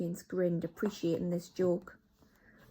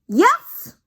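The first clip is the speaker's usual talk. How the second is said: much higher, much louder witch boom; triumphant roar; thundering yes; 17 dB louder than usual. Acted. Grand High Witch to her witches, loud triumphant yes. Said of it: "thundered the Grand High Witch".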